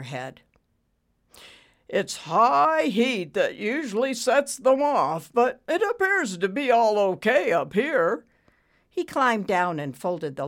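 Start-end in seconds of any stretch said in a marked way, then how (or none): 1.9-8.3 put-on old maintenance man. A narrator's reading. Dexter's character voice.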